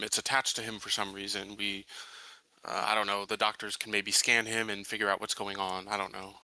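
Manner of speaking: ordinary talk, nothing marked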